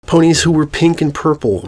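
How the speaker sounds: plain reading voice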